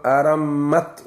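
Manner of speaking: "arammat" slow, with a long m and vowelless final t; doubled m. Shown as a demonstration. Teacher giving another reading, "arammat" with stressed double m. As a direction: hold the m in "arammat", stop on the t. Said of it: "wal miimi al-mushaddadati wa iskaani taa'i".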